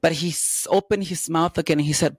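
ordinary delivery

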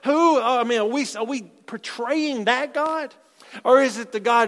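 normal talking voice

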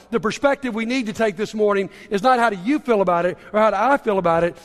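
normal talking voice

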